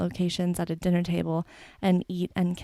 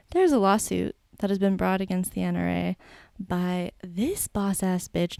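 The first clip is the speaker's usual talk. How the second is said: ordinary delivery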